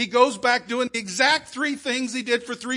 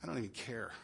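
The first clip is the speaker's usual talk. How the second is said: muttered low and much softer, a shrugging scoff; dismissive scoff; 9 semitones lower, 13 dB quieter than usual. Retold or imitated. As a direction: brush the church off as hypocrites, quietly. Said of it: "you know what the world says?".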